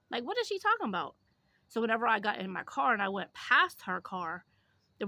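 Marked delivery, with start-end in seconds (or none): 0.1-1.1 high, fast disbelieving question; baffled disbelief. Retold or imitated. Asking a friend about the beggar's story, high fast disbelief. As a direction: shoot it up high in shock.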